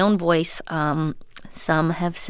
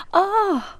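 ordinary talk, nothing marked

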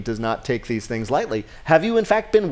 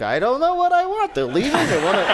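bad Queen Elizabeth; 10 semitones higher than usual. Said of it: "like, Queen Elizabeth".

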